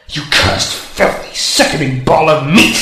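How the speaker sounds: hissed words rising to a loud snarl; furious curse. Acted. Disarmed robot cursing Sonic, building to a furious yell. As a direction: spit the insults in rage.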